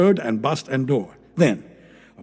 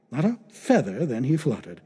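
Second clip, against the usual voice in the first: plain reading voice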